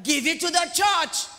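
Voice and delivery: forceful demand. Acted. Preacher presses unwelcome demand on congregation, forceful. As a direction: drive "Give it to the church" home loudly. Repeats what people dislike hearing.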